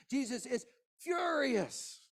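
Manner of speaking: furious preaching shout; preached shout. Real, not acted. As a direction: cry it out to the congregation.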